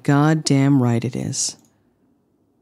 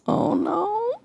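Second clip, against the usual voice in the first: mock panic; 6 dB quieter than usual. Dismayed at own character's injury.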